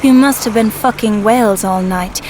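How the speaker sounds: normal talking voice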